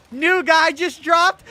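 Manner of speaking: loud, high, drawn-out hype call; hype announcement. Pretend. Joking to chat about liberal Ben Shapiro, loud hype call. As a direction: announce it like breaking news. Hyping a joke meme line.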